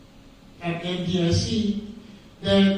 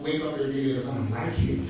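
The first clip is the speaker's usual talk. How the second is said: ordinary talk, nothing marked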